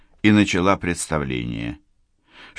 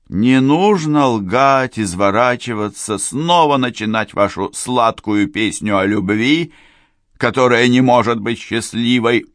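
stern reproach; 5 semitones higher, 5 dB louder than usual. Acted. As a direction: end it firmly. Woman's final reproach.

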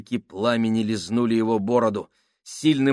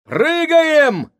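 high desperate yell; shouting; 9 dB louder than usual. A narrator's reading. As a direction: shout the order to jump. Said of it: "закричал Джордж".

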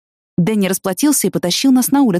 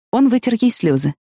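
plain reading voice

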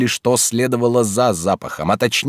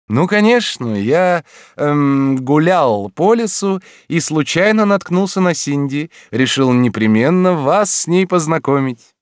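high, airy oddball teacher; eccentric teacher voice; 7 semitones higher, 4 dB louder than usual. A narrator's reading. Lorenz voiced by narrator. (чудаковатый учитель)

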